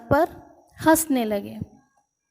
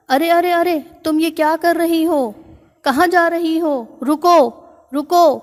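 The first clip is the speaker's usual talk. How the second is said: higher, louder panicked cries of a scared elephant; frightened elephant; 4 semitones higher, 4 dB louder than usual. Acted. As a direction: yell "stop" in alarm as the ants climb on. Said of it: "ہاتھی ڈر گیا". (ڈرے ہوئے ہاتھی کی اونچی گھبرائی ہوئی پکار)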